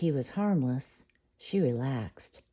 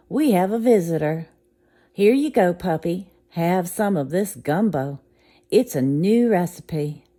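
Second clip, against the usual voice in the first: louder, cheery cook; friendly cook; 4 semitones higher, 6 dB louder than usual. Acted. Cook offering the puppy gumbo, loud and friendly. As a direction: coax the puppy kindly.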